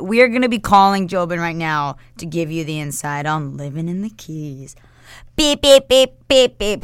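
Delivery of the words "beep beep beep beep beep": high, loud beeping phone tone; busy signal. Pretend. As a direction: imitate a ringing phone. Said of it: "Hold on, I'm calling him".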